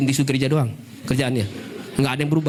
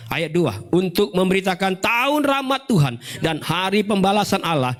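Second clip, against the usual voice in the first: raised, sustained declaiming read; scripture reading; 6 semitones higher than usual. Real, not acted. Preacher reads Isaiah to congregation, declaimed scripture reading. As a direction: proclaim the verses on a high carrying pitch. Reads Bible verses aloud.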